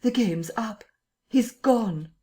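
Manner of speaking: despairing cry. A narrator's reading. Kemp despairs after the escape.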